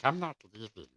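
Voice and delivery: hushed, possessive creature voice; lightly done creepy Gollum mutter; Smeagol-like. Retold or imitated. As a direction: whisper it like he won't let go. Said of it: "that sounded very Schmeegol-like".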